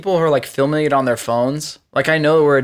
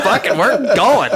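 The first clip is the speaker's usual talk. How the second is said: excited quote; 5 semitones higher, slower than usual. Retold or imitated. Excited guy grabbing truck.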